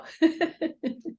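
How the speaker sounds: bright burst of laughter; laugh, high and quick; short high laugh. Real, not acted. [name] slow to unmute.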